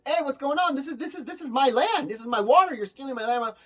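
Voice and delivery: high, loud indignant yelling; an owner confronting trespassers on his land, loud and outraged; angry landowner. Retold or imitated. Furious landowner, shouting at growers. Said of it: "the owner goes out there and goes".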